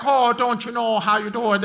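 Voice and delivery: lightly voiced Midwestern mom; a hint of a chirpy Minnesota mom; light mock of the mom from Bobby's World. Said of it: "Everyone sounded like the mom from Bobby's World".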